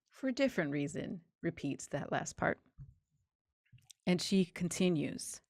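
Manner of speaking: normal talking voice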